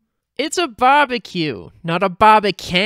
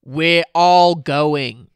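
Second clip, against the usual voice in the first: lower, slow growl; 6 semitones lower, much slower than usual. A narrator's reading. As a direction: drag it out low and firm, no arguing. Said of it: "Linda growled".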